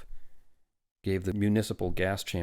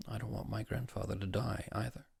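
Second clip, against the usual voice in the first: soft, subdued admission; quiet; 3 semitones higher, 8 dB quieter than usual. A narrator's reading. Father fears for his grandfather, quiet. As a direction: confess it in a small voice. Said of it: "said Welling quietly".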